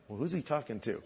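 under your breath, confused; puzzled aside. Retold or imitated. [name] wondering who the stranger calls, quiet puzzled aside. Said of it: "I'm like".

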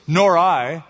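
loud, emphatic second refusal; firm agreement. A narrator's reading. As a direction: back up the refusal firmly. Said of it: "agreed a second".

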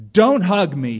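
slow, loud gruff refusal; growled. Acted. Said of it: "he growled".

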